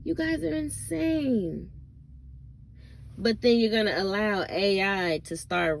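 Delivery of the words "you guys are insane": incredulous repeat. Real, not acted. Vlogger repeats the verdict on gossip fans, high and incredulous. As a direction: say it again, pitched way up in disbelief.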